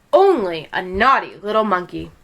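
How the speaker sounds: ordinary delivery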